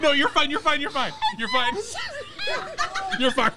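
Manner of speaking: giggling reassurance; laughing. Real, not acted. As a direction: laugh through it. Laughing while calming her.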